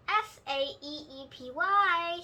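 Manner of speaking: letters "S A E E P Y" said one by one, slowly; spelled out. Acted. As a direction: spell "S A E E P Y" letter by letter so the child won't follow. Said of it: "she spelled".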